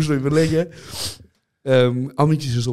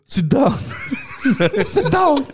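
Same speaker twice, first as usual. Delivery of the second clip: imitating him snapping at the game, pitched up; mock gamer rage; 11 semitones higher, slower than usual. Roommate imitating the gamer shouting sit down. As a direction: yell at the screen mid-game. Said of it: "Er so".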